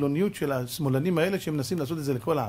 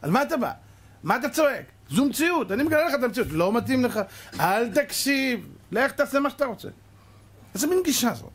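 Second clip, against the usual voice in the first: indignant patient; 8 semitones higher than usual. Retold or imitated. Angry patient scolds the doctor, higher indignant voice. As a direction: scoff at the bad news.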